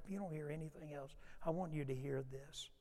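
urgent whisper to the room. Real, not acted. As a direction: lean in and whisper it. Preacher drops to whisper.

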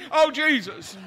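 mock agony after a crushed toe; retold pain moans. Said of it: "All I could say was".

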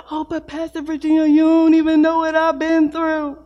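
a lightly voiced aggrieved listener; mock complainer. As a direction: play the hurt doubter, half mocking.

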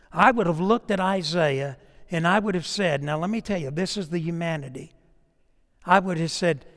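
ordinary talk, nothing marked

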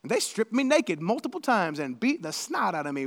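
normal talking voice

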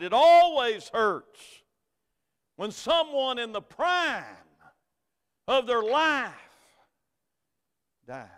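loud, high preaching climb; full-force preaching. Acted. Pastor preaching hope at a funeral, loud and high. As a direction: preach it out to the congregation.

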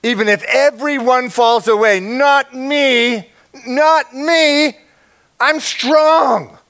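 high, loud cocky boast; boastful Peter. Pretend. Preacher mocking Peter's proud boast. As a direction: puff up like brash Peter bragging.

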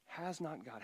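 quiet, pointed repetition of "has not God". Shown as a demonstration. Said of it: "It's a tad bit of sarcasm here".

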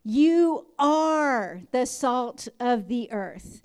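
very slow, solemn blessing; benediction. Acted. Pastor blessing standing congregation, slow and solemn. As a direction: pronounce the blessing slowly over them.

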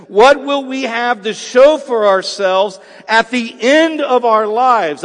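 loud, slow, emphatic pulpit delivery; fervent sermon climax, hammering every word; preaching at full force. Acted. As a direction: drive each phrase home from the pulpit. Preaching on profit.

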